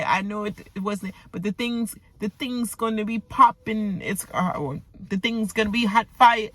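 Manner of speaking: a lightly voiced Jamaican patois impression; a gentle nod to a Jamaican patois lilt; Jamaican patois imitation. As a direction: echo her Jamaican patois with affection. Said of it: "I like when she speak that patois".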